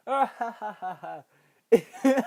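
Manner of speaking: high, loud creepy clown laugh; clown cackle. Acted. Pennywise mocking Ben, high loud cackle.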